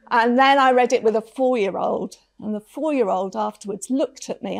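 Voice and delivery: normal talking voice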